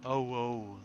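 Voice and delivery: sudden loud alarmed oh; startled by the lag. Real, not acted. Performance suddenly struggling.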